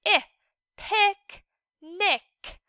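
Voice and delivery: short I, then picnic; short I sound. Shown as a demonstration. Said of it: "we hear the short I sound".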